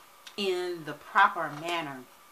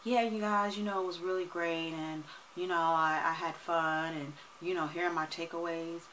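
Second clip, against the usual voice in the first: quiet, listless rundown; low-energy recap; 6 dB quieter than usual. Shown as a demonstration. Mock lazy video recap to viewers, listless. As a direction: drone through it half-heartedly. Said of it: "I was doing it like that".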